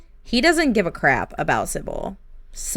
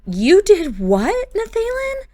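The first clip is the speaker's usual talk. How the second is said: shocked; 12 semitones higher, 5 dB louder than usual. Acted. Wife learns of memory potion.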